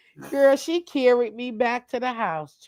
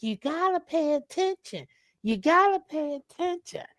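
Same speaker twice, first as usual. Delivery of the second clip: grandmother warning; 5 semitones higher, 5 dB quieter than usual. Retold or imitated. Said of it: "my grandmother was like".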